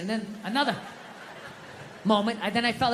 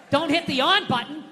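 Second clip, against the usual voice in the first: ordinary talk, nothing marked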